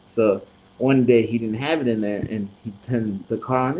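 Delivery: ordinary delivery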